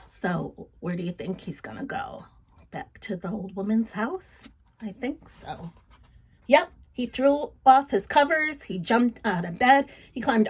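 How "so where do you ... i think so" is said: put-on soft whisper to the children; storyteller whispering to young listeners; hushed aside. A narrator's reading. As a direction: lean in and whisper the question.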